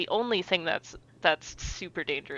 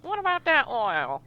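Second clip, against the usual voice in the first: put-on old prospector; 8 semitones higher than usual. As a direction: echo the high old prospector voice. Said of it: "say it like an old prospector".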